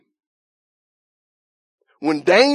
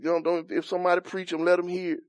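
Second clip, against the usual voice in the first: softer, lower, perfunctory plea to God; pat prayer; 4 semitones lower, 8 dB quieter than usual. Acted. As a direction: toss off a routine prayer under your voice. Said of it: "now it's just pat prayers".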